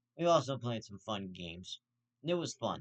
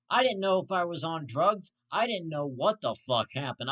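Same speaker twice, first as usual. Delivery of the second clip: raised, higher voice in real outrage; disbelieving rant; 4 semitones higher, 5 dB louder than usual. Real, not acted. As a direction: vent your disbelief loudly. Ranting in disbelief.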